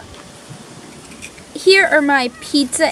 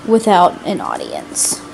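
ordinary talk

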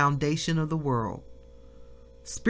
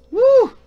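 high, loud whoop of praise; joyful shout; 12 dB louder than usual. Real, not acted. Preacher whooping in praise to the congregation. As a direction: let out a loud church whoop.

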